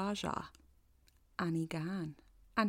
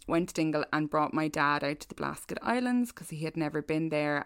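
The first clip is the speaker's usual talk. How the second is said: ordinary talk, nothing marked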